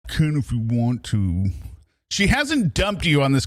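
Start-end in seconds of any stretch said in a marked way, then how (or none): 0.1-1.5 lightly mimicked, slow retort; imitating her. Streamer mimicking the girlfriend's dismissive reply. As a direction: echo her cool brush-off. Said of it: "Lauren, you little b".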